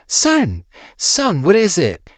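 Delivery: high, anxious fatherly voice; worried dad. Acted. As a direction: ask in alarm. Worried dad sees son.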